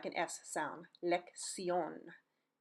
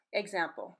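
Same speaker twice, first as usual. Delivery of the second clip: ordinary talk, nothing marked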